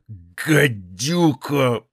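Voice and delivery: very slow hoarse growl of disgust; hoarse rasp. A narrator's reading. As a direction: rasp the word out slowly. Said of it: "прохрипел".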